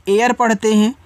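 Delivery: usual speaking voice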